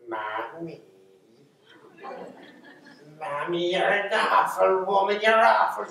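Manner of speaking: put-on high, loud monstrous child voice; changeling child voice. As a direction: spit it out like a nasty changeling brat. Changeling child berating mother.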